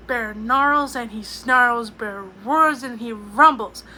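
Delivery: the angry bear wakes up roaring; loud growling read. A narrator's reading. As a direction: roar the words out like the bear.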